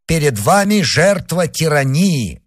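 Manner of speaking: high, firm solemn pronouncement; Spartan declaration. A narrator's reading. Answering the wounded man's son, proud Spartan declaration. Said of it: "как истый спартанец".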